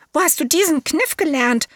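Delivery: high, astonished question; wide-eyed relatives asking; relatives' voice. A narrator's reading. Brothers ask the elephant child, high and astonished.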